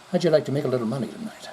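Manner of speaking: lightly voiced smooth fast come-on; shady rich stranger. Host imitating a shady guy in a Rolls Royce, fast. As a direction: rattle it off like a slick rich guy. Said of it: "a Egyptian guy that just pulled up".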